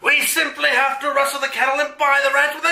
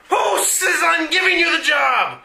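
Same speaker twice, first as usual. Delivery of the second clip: indignant villain shout; 6 semitones higher than usual. Acted. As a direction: bellow it like an offended villain. Villain outraged.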